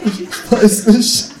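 slow, strained, half-laughing; mock broken. Narrator caves to kangaroo, slow and strained. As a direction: drag it out like cracking. Narrator cracks under questioning.

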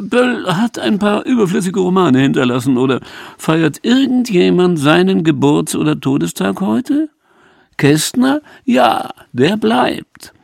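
high-pitched, emphatic interviewer figure; acted interviewer's voice. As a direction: pronounce judgement high and insistent, stretching the end. Acted reply of pompous interviewee. (hoher, nachdrücklicher Redakteur)

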